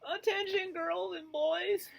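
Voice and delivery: high, slow howled call to the class. Acted. Principal calling the noisy class to order, howling. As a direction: stretch it out like a principal summoning kids. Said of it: "appeared and howled".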